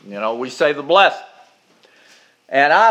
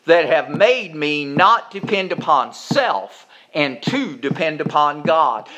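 usual speaking voice

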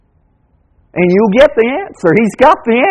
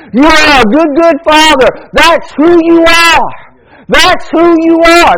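raised, slow emphatic declaration; preaching shout; 6 semitones higher than usual. Real, not acted. Preacher proclaiming to the congregation, slow and raised. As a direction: call it out to the whole church.

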